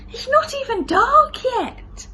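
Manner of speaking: put-on high, slow childish complaint; a small boy protesting bedtime, slow and high; child's whine. Lester protesting early bedtime, high and slow.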